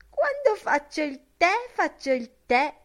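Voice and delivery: put-on high, mincing old-woman voice; prim, affected granny telling you her rule. A narrator's reading. Buck Mulligan mimicking a prim old woman at breakfast, high and mincing. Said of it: "con una voce da vecchietta smancerosa".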